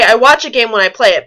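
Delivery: usual speaking voice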